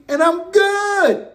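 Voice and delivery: high, very slow, drawn-out good; stretched-out smug finish; gloating satisfaction. Real, not acted. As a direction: savour it smugly. Gloating over a tie.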